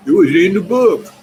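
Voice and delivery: loud, high mock declaration; high-pitched parody of rote learning; mock textbook quoting. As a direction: belt it out high like a pat answer. Mimicking a textbook-only scholastic answer.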